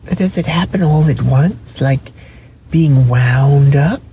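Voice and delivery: high, timid little rabbit; wide-eyed velveteen rabbit asking; small rabbit voice. Acted. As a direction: ask it like a shy toy rabbit. Rabbit voice, higher.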